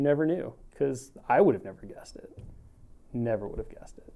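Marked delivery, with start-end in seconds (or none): 1.5-4.1 soft whispered aside. Real, not acted. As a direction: whisper it under your breath. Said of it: "whisper heard".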